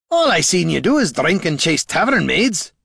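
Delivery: gruff warrior voice. Acted. Gorm retorts.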